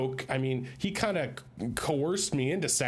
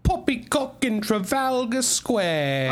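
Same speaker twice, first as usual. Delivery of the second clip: high, loud mock Cockney; terrible Cockney; 9 semitones higher, 5 dB louder, slower than usual. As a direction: belt out a hammed-up Cockney taunt. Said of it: "You're doing a terrible accent".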